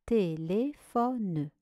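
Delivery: "téléphone" slowly, each syllable apart. Shown as a demonstration. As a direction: read "téléphone" back slowly in four syllables. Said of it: "Voici la correction". (« téléphone » lentement, syllabe par syllabe)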